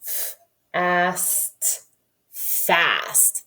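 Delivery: "F, a, s, t" said quickly, then blended into "fast". Shown as a demonstration. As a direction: run the sounds together into one word. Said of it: "say it a little faster".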